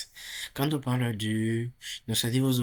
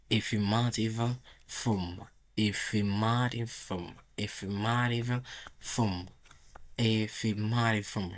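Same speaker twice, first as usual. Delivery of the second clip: slow, careful model of "Affirmative form"; slow drill of affirmative form; slower than usual. Shown as a demonstration. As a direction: say "Affirmative form" slowly, word by word. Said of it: "en anglais, on dit affirmative form". (prononciation lente de « Affirmative form »)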